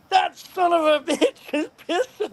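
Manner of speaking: high, laughing through the sheriff's line; retelling the sheriff's words while laughing; laughing quote. Retold or imitated. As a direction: break into laughter mid-quote.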